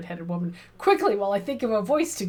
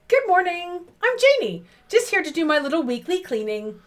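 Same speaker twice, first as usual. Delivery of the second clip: Midwest mom voice; 3 semitones higher, 4 dB louder than usual. Acted. Janie introduces herself as a cheerful Midwest mom. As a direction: greet like an eager Midwest mom. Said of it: "Midwest mom voice".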